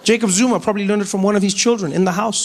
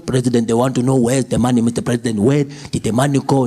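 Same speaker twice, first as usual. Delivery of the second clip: low comrade voice; 8 semitones lower than usual. Retold or imitated. Comrades questioning the president.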